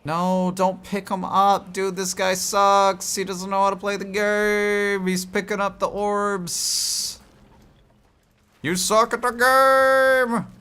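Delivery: mock the player in a shrill squeak; high-pitched whiny voice. Mocking squeaky other-character voice.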